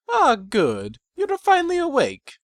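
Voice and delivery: a stranger pony greets the waking hero with a high, sly voice; untrustworthy voice. Acted. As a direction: greet him sweetly but not to be trusted. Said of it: "a voice I instantly didn't trust".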